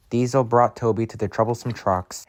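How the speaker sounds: ordinary delivery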